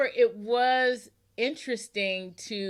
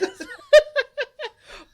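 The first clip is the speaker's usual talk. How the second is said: big high laugh, bursting out; a sudden hearty laugh; loud burst of laughter; 11 dB louder than usual. Real, not acted.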